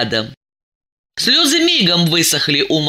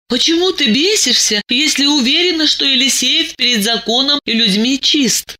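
wearily; 8 semitones higher than usual. A narrator's reading. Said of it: "устало спросила она".

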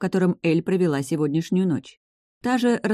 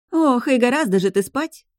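high bright teasing chirp; 8 semitones higher, 4 dB louder than usual. A narrator's reading. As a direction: chirp it like a happy bird. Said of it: "снова прощебетала Инни".

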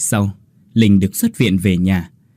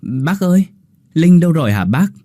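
higher, respectful call to an elder; polite greeting; 6 semitones higher than usual. Acted. Quyết politely asks the mother for Linh. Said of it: "anh cũng lễ phép".

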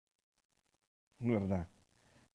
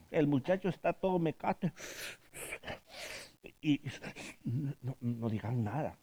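usual speaking voice

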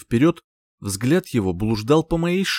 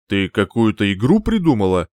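uncertain; 3 semitones lower, 3 dB louder than usual. A narrator's reading. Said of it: "неуверенно спросил принц".

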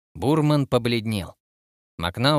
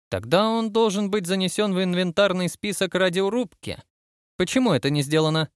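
lightly voiced admiral, pitched higher; inspecting admiral; 7 semitones higher than usual. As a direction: read out inventory items as an inspecting admiral. Admiral questions the captain.

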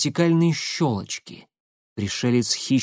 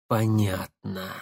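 тихо, медленно, свысока; отрывисто и холодно; небрежно бросил; 4 semitones lower, 5 dB quieter, slower than usual. Acted. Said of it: "бросил он". (небрежно)

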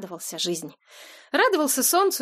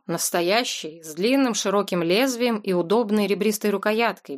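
ordinary delivery

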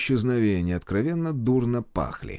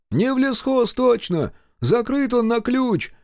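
guest calling out; 13 semitones higher, slower than usual. Acted. A guest shouts from the crowd.

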